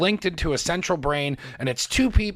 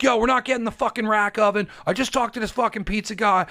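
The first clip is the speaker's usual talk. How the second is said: higher pitched, worked-up rant to a friend; fired-up rant; 6 semitones higher than usual. Retold or imitated. Calling Gina from the car, higher and all worked up. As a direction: blurt it all out, fired up and swearing. Retelling excited call to Gina.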